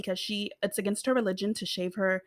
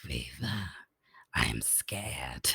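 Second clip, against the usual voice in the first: slow, unvoiced, much lower and softer croak; hoarse child whisper; 10 dB quieter, slower than usual. Imitating the scared girl telling Viva she is afraid. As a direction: whisper it scared through wrecked vocal cords. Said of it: "That's how she talks".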